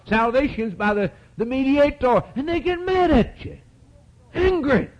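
high, insistent pulpit cry; high-pitched sermon in full flow; fervent preaching. Real, not acted.

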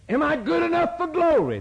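a hint of anxious sinner. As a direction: mimic a doubting believer.

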